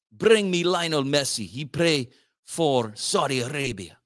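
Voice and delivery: put-on a clumsy Russian accent; bad Russian impression. Mock Russian accent to the crowd. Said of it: "See, I'm not good at impressions".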